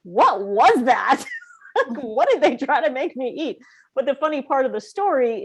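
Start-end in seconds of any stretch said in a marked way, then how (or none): 0.0-3.6 lightly voiced child, slow and baffled at first; imitating a puzzled little girl. Daughter back from her first preschool day, baffled. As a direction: play the kid back from preschool, bewildered. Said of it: "daughter home from preschool: like".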